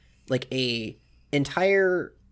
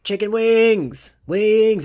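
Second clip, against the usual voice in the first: high, loud drawn-out chant for food; rowdy shout; 8 semitones higher, 9 dB louder, much slower than usual. Acted. Rowdy customer in the back demands food, shouting. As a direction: holler it out long from the back. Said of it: "Everybody shouts".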